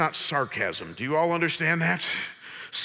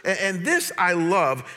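ordinary talk, nothing marked

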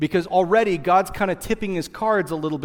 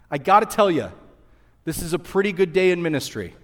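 ordinary talk, nothing marked

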